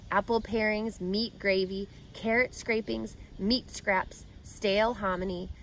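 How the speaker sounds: plain reading voice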